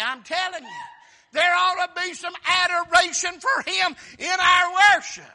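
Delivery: building from eager to loud, shouted preaching; preaching at full force. Acted. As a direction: whip the congregation up.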